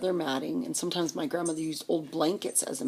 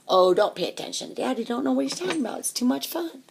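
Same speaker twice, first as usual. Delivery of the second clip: put-on loud, pitched-up kid brushing it off; dismissive brother; 8 semitones higher, 6 dB louder than usual. Brother to sister about Daddy, dismissive. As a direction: wave the warning away like a cocky child.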